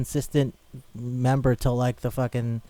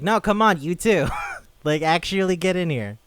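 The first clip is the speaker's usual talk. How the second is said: higher, louder urging; calling out; 6 semitones higher, 5 dB louder than usual. Acted. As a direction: beckon them in, voice lifted and loud. Voices characters beckoning her to join.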